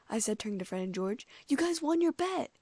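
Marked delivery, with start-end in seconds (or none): 1.5-2.6 high, loud happy cheer; excited congratulation. A narrator's reading. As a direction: exclaim it, delighted for them. Cheering the twins' winning bet.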